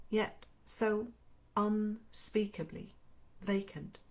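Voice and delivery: audiobook reading, unhurried and even; story narration. Acted. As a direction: read the novel aloud to your viewers. Reads novel chapter aloud.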